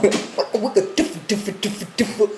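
vocal DJ scratch imitation; record scratch noises. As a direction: scratch it with your mouth, rhythmic. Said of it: "Did you put this on the record? Was it".

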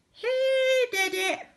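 very high silly bird squawk; parrot squawk. A narrator's reading. Purple parrot blaming Pete, high squawk. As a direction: screech it like a tattling parrot. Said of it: "squawked the silly bird".

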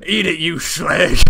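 loud, high, drawn-out mock yell; retold shout. Player retelling a game night to mates, shouting. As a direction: re-enact a shouted insult at full volume, slowly. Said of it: "we both shouted something like".

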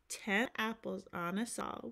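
ordinary delivery

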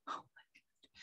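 breathless whispered oh my God; whispered aside. Real, not acted. Telling of the gas chamber, a horrified whisper. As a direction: whisper it under your breath.

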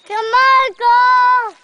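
loud, high yell to urge on. Real, not acted. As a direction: yell at the animal to move. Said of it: "Don't yell".

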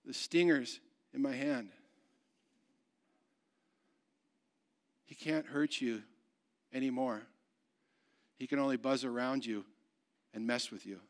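hushed, low, with long pauses; tender reassurance. Acted. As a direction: softly settle a frightened child's panic. Father reassures frightened child.

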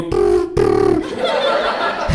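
very low rolled ring sound; faking a phone ring back at the caller; phone ring imitation. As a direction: trill like a ringing phone.

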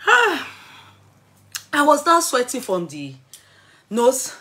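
usual speaking voice

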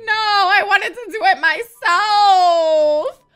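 loud, high, slow mock wail; mock crying wail. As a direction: wail it out as the upset character. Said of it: "She was like".

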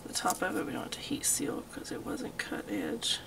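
hushed whisper while focused on the flame; whispered aside. Real, not acted. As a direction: keep it to a careful whisper. Aside while heat sealing.

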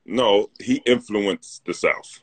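slow, weighted rebuttal with the stress on he; slow insistence. Real, not acted. Correcting his co-host in a teasing rivalry, slow and emphatic. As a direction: lean on he and draw the line out. Friendly argument over North and South.

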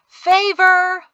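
high, loud crowd cry; loud chorus. A narrator's reading. Crowd answering the vote, a loud cry. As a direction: shout it out with the crowd. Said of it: "A loud chorus of".

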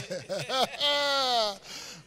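long drawn-out laugh. Real, not acted. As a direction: let a long laugh roll out. Laughing with the congregation.

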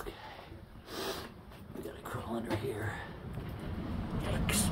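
hushed warning whisper. Real, not acted. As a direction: whisper while crawling. Crawling into tight passage.

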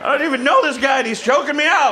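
normal talking voice

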